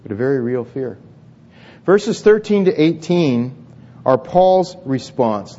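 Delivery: ordinary delivery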